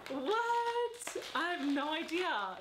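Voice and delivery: a high, drawn-out incredulous what; stunned at the odd packaging; baffled. Real, not acted. Vlogger opens the strange parcel, baffled.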